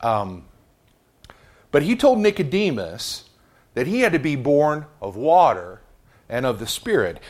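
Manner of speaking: normal talking voice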